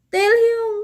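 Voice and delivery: high loud mock yell; mock scream. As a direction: echo the scream jokingly. Reader mimics the scream.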